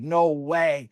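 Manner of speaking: retold incredulous outburst; imitated freak-out. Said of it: "he freaked out".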